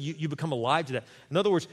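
ordinary delivery

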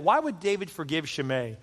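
ordinary talk

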